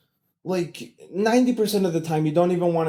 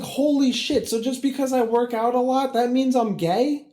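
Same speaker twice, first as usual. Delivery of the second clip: indignant; 8 semitones higher than usual. Retold or imitated. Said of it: "And I'm just like".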